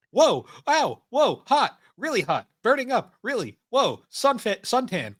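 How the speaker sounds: high, loud yelping whoa hot; burning stuntman. Retold or imitated. Co-host quotes the stuntman's exact lines in high yelps. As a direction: yelp each word like you're on fire. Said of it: "his exact quote is".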